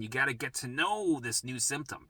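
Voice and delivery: ordinary talk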